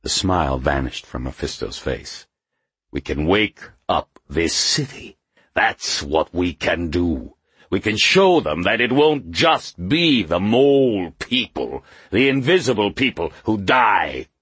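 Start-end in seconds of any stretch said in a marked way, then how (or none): 2.9-14.3 building to a louder, higher cry; rally the city, swelling up; rising fervor. A narrator's reading. Rousing rising outburst.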